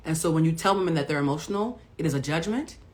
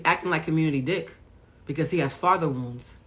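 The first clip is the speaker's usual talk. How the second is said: normal talking voice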